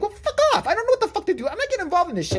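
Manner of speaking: very high, exasperated rant; exasperated refusal. Retold or imitated. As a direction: act out refusing to get involved. Retelling angry refusal on phone.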